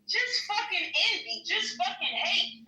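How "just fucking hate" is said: ordinary delivery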